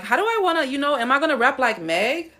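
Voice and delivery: ordinary delivery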